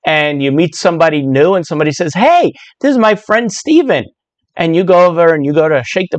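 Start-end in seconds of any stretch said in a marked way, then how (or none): 2.1-4.1 cheerful introducer. Pretend. Acted barbecue introduction.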